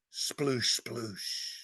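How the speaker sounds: hushed, falling sploosh sounds; imitated splashing. Squad turning round in the lake, quiet imitated splashing. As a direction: let each soft sploosh trail away.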